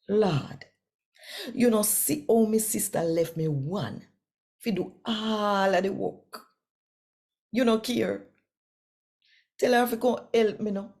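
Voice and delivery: lightly voiced fretful Martha; complaining Martha. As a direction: beg the Lord to make her sister help.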